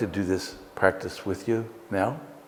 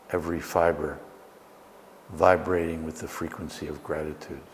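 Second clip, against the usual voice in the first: guided meditation; 2 semitones lower than usual. Acted. Leads a guided breathing meditation.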